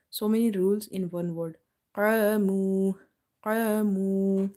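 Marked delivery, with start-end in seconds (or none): 1.9-4.5 Qamu loud, with long dark vowels; stretched heavy alif. Shown as a demonstration. Teacher applies the rules in one word, heavy and stretched. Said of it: "we read it by stretching because alif is madda".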